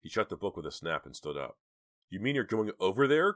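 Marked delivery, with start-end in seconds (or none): 2.1-3.3 high, loud disbelieving question; horrified cry. A narrator's reading. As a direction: cry out in horror. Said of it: "cried Dina, horrified".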